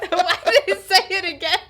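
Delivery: high, loud real laughter breaking into the question; cracking up at the line; laughing. Real, not acted. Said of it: "It's a totally different".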